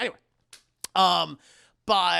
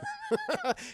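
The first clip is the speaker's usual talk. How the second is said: high laugh, trailing softer; real laughter; 4 dB quieter than usual. Real, not acted. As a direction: keep giggling at your own joke. Laughs at own joke.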